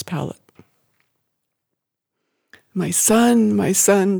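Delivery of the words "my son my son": grieving, higher-pitched lament; restrained sobbing. A narrator's reading. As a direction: mourn a child, close to tears. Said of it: "she sobbed".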